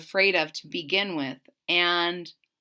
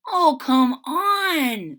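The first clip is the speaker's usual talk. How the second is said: coaxing; 6 semitones higher, 7 dB louder, much slower than usual. A narrator's reading. Brother coaxes sister.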